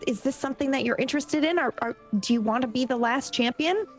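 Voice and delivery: ordinary delivery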